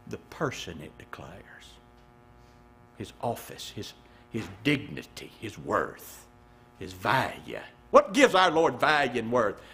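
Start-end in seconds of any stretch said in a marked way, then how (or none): 0.1-7.6 much softer and lower, nearly a whisper; hushed reverence. Real, not acted. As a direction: linger on each word in awe.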